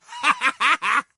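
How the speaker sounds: bright teasing laugh; cheeky laugh after a taunt; mocking laugh. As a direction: laugh at Rubio's bad luck. Handler mocks absent target.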